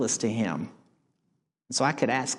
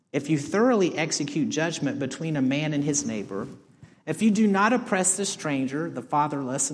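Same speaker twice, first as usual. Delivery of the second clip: normal talking voice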